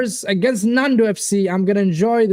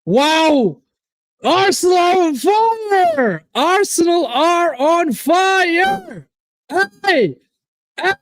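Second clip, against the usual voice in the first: goal celebration shout; 10 semitones higher, much slower than usual. Real, not acted. Fan erupting as Arsenal score, roaring. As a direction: scream the goal like a stadium.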